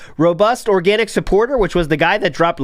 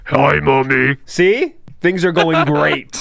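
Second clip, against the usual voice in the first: morphing voice; 4 semitones lower, slower than usual. Retold or imitated. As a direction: lower voice-changer tone. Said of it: "Listen to this voice changer, Mommy".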